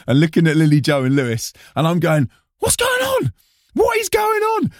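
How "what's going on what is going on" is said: very high, strained mock shout; mock panic. Performer turning to bandmates on stage, mock panicked shout. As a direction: act out a bewildered squeal.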